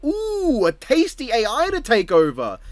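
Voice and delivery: high, swooping gleeful "ooh"; Ultron relishing. Acted. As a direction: savour it like a treat, pitched high. Voicing Ultron eyeing a victim.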